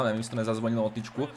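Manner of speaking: ordinary talk, nothing marked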